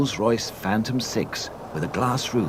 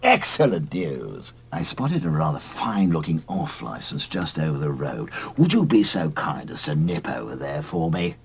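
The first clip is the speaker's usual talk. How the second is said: a hint of a lower, genteel old man; polite old boozer asking a favour; lightly voiced old gent; 6 semitones lower than usual.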